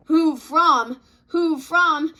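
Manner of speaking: 1 mocking. Annoyed mocking reply.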